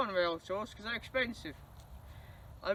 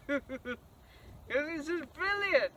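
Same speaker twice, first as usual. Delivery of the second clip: laughing; 5 semitones higher, much slower than usual. Real, not acted. Laughing while praising her train.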